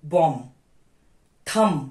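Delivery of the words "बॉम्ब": बॉम्ब said bom, no final b; बॉम्ब ends on a clean m; silent b. Shown as a demonstration. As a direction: drop the b in बॉम्ब. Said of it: "बॉम्ब नहीं बोलना है".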